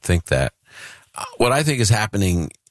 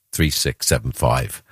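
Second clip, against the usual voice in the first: ordinary talk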